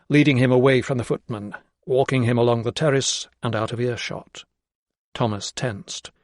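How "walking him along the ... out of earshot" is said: usual speaking voice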